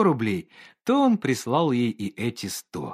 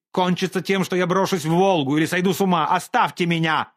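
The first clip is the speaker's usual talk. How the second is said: higher, louder and fast, losing control; desperate outburst; 7 semitones higher, 4 dB louder than usual. Acted. Painter bursts out at Olga that he will drown himself, frantic. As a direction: blurt it out on the brink.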